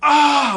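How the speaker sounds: loud, high burst of rage; angry yell. Retold or imitated. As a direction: let out a furious yell. Rage before smashing bottles.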